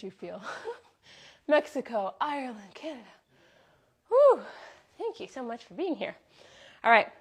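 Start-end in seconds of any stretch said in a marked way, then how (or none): 4.1-4.4 loud relieved whew. Real, not acted. Relieved exhale after the set.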